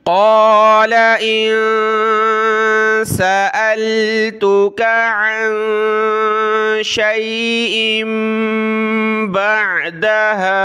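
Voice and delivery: slow melodic chant; slow melodic Quran recitation. Shown as a demonstration. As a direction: recite like a qari, every sound stretched. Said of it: "full ayah recitation".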